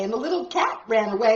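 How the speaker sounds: usual speaking voice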